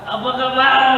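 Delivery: high, projected call to the crowd; chest-voice greeting. Shown as a demonstration. Co-host demonstrating chest breathing to the audience, projected and high. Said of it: "itu memakai napas dada".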